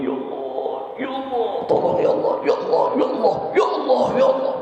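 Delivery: put-on high pleading child's whine; preacher acting out an insistent whining prayer, high whine; whining plea. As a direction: beg like a crying child asking its parents. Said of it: "Mintanya kayak begitu".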